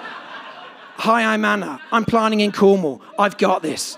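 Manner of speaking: loud, laughing self-affirmation; giggling hammed-up confidence message; laughing mock pep talk. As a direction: read out her voicemail while laughing. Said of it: "Do you play messages to yourself".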